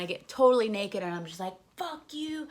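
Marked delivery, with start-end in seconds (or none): 1.8-2.5 high, held-back jeer; pitched-up retold taunt; mock defiance. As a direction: toss it out in a small high voice. Flashing everyone in defiance.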